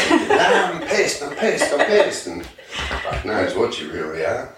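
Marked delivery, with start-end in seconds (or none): 0.1-2.3 high, laughing drunk-kid impression; mock drunk kid laughing. A groomer's teen customer, tipsy on two cans, laughing and high. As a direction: giggle it out like a tipsy teen boasting.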